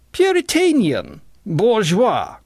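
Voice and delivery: very high, loud jeers; high-pitched crowd denunciation; crying out. A narrator's reading. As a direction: hurl each insult high and drawn-out. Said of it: "cried the Clevers".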